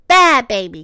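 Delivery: put-on loud little boy's telling-off; scolding cry. A narrator's reading. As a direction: cry out a sharp reprimand. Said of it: "cried the little boy".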